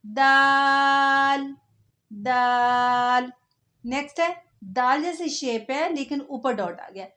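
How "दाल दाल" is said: "दाल" held very slowly, half sung; drawn-out letter drill. Shown as a demonstration. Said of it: "letter drill". ("दाल" धीरे खींचकर गाते हुए)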